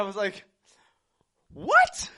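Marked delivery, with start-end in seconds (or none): 1.5-2.1 high, loud disbelieving what; shocked what. Retold or imitated. Retelling his shocked reply to his wife's Christmas demand. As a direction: yelp it in total disbelief.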